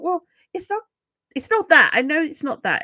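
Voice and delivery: mock exasperation, pitched high and settling; telling yourself off in a high voice; exasperated self-correction. Acted frustrated self-correction while recording.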